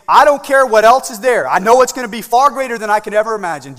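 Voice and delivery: high, loud burst of conviction; fervent declaration. Acted. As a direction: proclaim it forcefully from the pulpit. Acts out a believer's fervent declaration.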